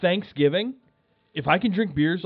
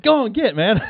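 shooing call; 7 semitones higher, 5 dB louder than usual. Retold or imitated. Shooing the raccoon again.

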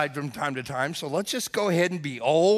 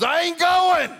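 high, loud mock-husband refusal; defiant shout; 12 semitones higher, 6 dB louder than usual. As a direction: holler a stubborn refusal from under the covers.

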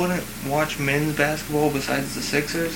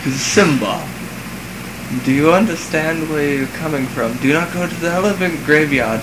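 put-on British accent; 3 semitones higher, 4 dB louder than usual. Friend playing a British Mufasa, mock posh British accent. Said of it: "picturing a British guy".

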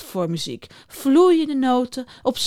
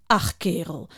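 soft, lower grumbling; grumpy; 5 semitones lower, 5 dB quieter than usual. Acted. Juniper grumbles at the beaver, mildly grumpy. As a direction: grumble it dismissively. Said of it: "zegt hij narrig".

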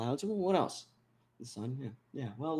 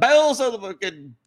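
mock Southern preacher; 7 semitones higher, 5 dB louder than usual. Mock preacher asked where from.

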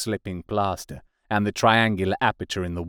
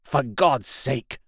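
higher, slow, desperate appeal kept from shouting; terrified pleading; 5 semitones higher, slower than usual. A narrator's reading. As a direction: beg him in fear of being overheard. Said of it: "in a terror lest the Martians should hear us".

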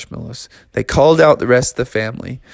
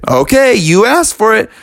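defiant bears; 9 semitones higher, 5 dB louder than usual. Acted. Bears accept the challenge.

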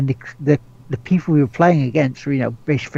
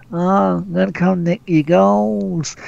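put-on Yorkshire accent; 4 semitones higher than usual. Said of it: "we have to do his Yorkshire accent".

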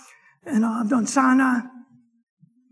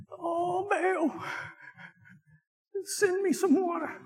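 higher, softer mock whine to Baal; mock pleading prophet; 6 semitones higher, 9 dB quieter, slower than usual. Preacher mocking prophets begging Baal, soft whine. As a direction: whimper for water, lightly mocking. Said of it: "Can you imagine the scene?".

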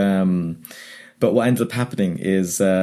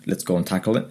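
normal talking voice

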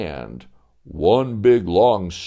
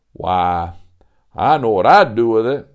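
a visitor greeting grandly at the door; folksy old-timer Sebastian; 7 dB louder than usual. Acted. Sebastian voiced.

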